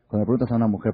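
ordinary talk, nothing marked

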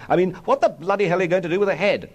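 fast, high-pitched scolding; exasperated demand. A narrator's reading. Policeman father berates the street, exasperated demand.

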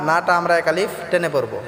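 normal talking voice

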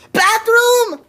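loud, high desperate cry; urgent shout. Acted. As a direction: yell it in a panic. Sudden urgent need.

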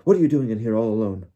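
loud, quick questioning; startled demand. A narrator's reading. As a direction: fire the question out loudly. Bagman startled at finding them.

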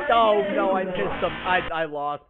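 ordinary talk, nothing marked

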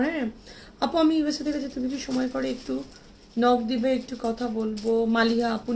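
ordinary talk, nothing marked

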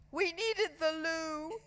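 put-on high, slow little-boy plea; stretched-out wheedling excuse; boy's whiny excuse. A narrator's reading. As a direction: plead innocence in a high voice. Caught boy making an excuse.

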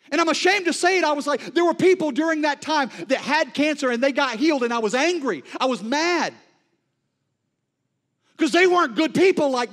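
loud, high, impassioned preaching that slows to drag out each shaken line; preaching at full force. Acted. Preacher voicing the prophet's fear to the congregation, full-force preaching. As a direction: preach it like the storm is already here.

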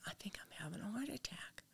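faint whispered realization. Retold or imitated. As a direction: whisper it, barely any voice, re-enacting the moment. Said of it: "I remember whispering".

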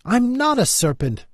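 high-pitched, indignant denial. A narrator's reading. Alice denies the pigeon's accusation, indignantly. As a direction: protest it sharply, voice jumping up high. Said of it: "said Alice indignantly".

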